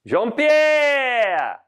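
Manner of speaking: high, long-held yell that falls away; calling out. Acted. Chef summoning his absent sous chef, high drawn-out call. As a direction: holler for someone in another room.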